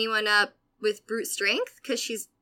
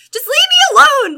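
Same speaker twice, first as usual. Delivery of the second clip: much higher, loud retold yell; 14 dB louder than usual. Teen yelling at the bully on the floor, loud and high. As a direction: shout her off at full force. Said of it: "continuously yelling".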